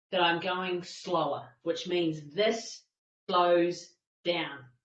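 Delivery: very slow, low, deliberate pace; slow right down, with pauses between words. Shown as a demonstration. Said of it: "tell in the way that I'm talking now".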